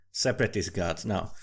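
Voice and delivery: ordinary talk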